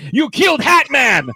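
high, loud accusing shout; mock outraged cry. Mock outraged cry, laughter around.

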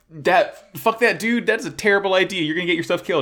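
high-pitched, protesting official; alarmed objection. Retold or imitated. Mayor warning against a deadly plan, high-pitched and alarmed.

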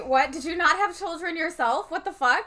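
high, incredulous outburst; indignant disbelief. Real, not acted. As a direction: challenge her, outraged and baffled. Host indignant at grandma's attitude.

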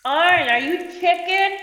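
high, drawn-out taunting call; slow, high-pitched heckle; yelled taunt. Acted. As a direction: yell a dare up to someone above. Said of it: "As, as all of you are yelling".

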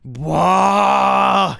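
very high, loud bellow; outraged vampire bellow. Acted. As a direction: explode in outrage. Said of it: "he said".

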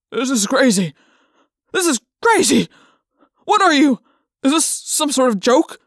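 loud, rising panic; can't believe what's happening; frightened disbelief. Acted. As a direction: trainer squeezed by an elephant, frightened. Terrified trainer disbelieving.

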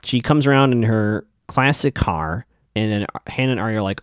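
normal talking voice

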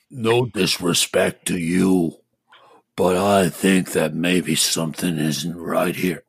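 deep gorilla voice. Acted. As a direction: rumble it like a big gorilla.

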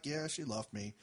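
low, soft mopey voice; low, muttered heartbreak, mildly hammed. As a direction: play the dumped friend sulking. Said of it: "And he's like".

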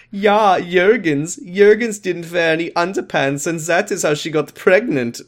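hammed-up German accent teasing a classmate; funny German voice. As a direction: stretch out Juergens the German way. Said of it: "doing funny German voice".

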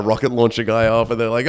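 usual speaking voice

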